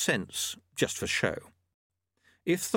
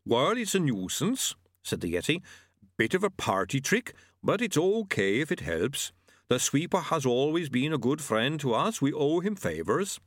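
higher-pitched, easygoing creature voice; obliging yeti; 6 semitones higher than usual. Acted. Yeti shrugging off a beheading for old friends, obligingly. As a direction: play an accommodating yeti, pitched up. Yeti's held character voice.

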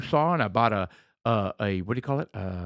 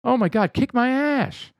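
higher, exasperated outburst; exasperated cry; 10 semitones higher than usual. Retold or imitated. To his friends about the angles, exasperated. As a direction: wail that it beat you. Frustrated recalling hard woodwork.

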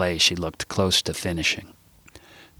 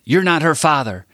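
loud, flat insistence; firm denial; 8 semitones higher, 5 dB louder than usual. Acted. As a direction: say it like you mean it. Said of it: "She sounded like she meant it".

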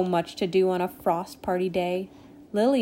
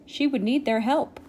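ordinary delivery

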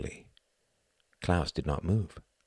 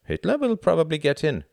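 put-on a higher German-accented voice for Klaus; 12 semitones higher, 4 dB louder than usual. A narrator's reading. Klaus shrugging off Tom's worry, German accent. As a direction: keep Klaus's German lilt.

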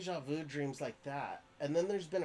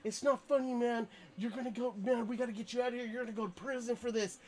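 high, hurried scolding voice; frantic high scolding; worried friend; 9 semitones higher, faster than usual. Retold or imitated. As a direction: rush it out, high and panicky. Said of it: "And he's just like".

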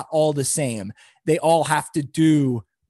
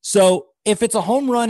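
ordinary delivery

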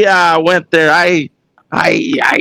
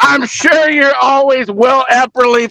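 big show announcer voice; 5 semitones higher than usual. Shown as a demonstration. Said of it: "Now I gotta get into character".

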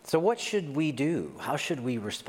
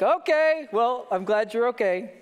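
awkward cheery reply; 9 semitones higher, 4 dB louder than usual. Retold or imitated. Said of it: "And so I was like".

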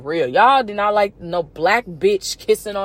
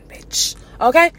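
usual speaking voice

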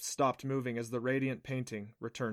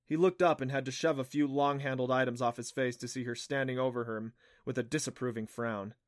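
usual speaking voice

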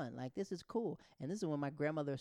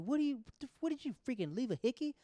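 high-pitched, outraged questioning; incredulous accusation; 10 semitones higher, 4 dB louder than usual. Acted. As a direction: act out a scandalized friend accusing you. Friend's accusation acted out.

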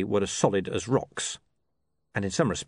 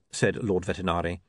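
plain reading voice

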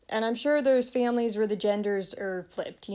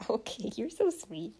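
laughing tenderly; 3 semitones higher, 4 dB quieter than usual. Real, not acted. Mother laughing with her baby.

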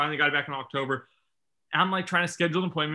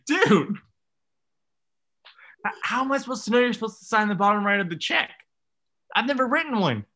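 exasperated protest; 5 semitones higher than usual. Retold or imitated. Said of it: "He's like".

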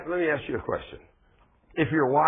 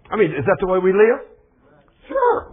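much louder, higher, pushed; loud rhetorical outburst; 7 semitones higher, 9 dB louder than usual. Real, not acted. Preacher challenging the congregation, loud and high. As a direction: hammer the question home to the church.

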